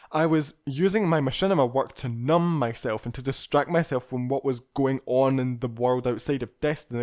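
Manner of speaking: ordinary delivery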